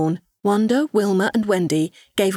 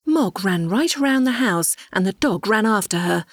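plain reading voice

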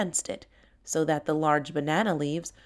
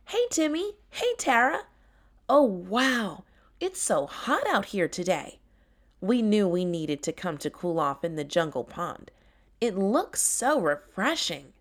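cheerful tiger Tessa; 2 semitones higher than usual. Acted. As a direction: burst in happy and hot. Tessa arrives to swim.

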